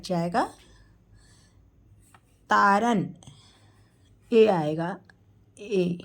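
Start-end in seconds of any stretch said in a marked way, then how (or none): none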